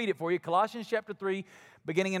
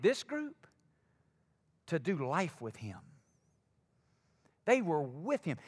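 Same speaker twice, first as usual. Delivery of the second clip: hushed sermon; 4 semitones lower, 4 dB quieter than usual. Acted. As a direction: draw them in quietly, unhurried. Slow, soft reflective preaching.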